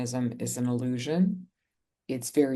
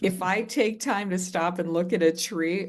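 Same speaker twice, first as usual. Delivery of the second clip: higher, louder exasperated yell; retold outburst; 5 semitones higher, 4 dB louder than usual. Snapping at her mother on the phone, higher and louder in exasperation. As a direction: throw her mother's advice back at her, fed up. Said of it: "I flipped out".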